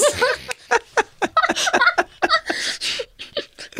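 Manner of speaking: high squealing laugh that tips into crying. Real, not acted. Laughing hard at the Keeping Up joke, high squealing laugh.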